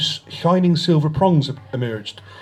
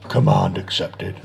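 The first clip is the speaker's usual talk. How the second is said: much lower, slow sinister alien voice; cold alien reply; 6 semitones lower, slower than usual. A narrator's reading. Asbo accepting the cruel order, lower slow menace. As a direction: answer coldly and slowly, with menace.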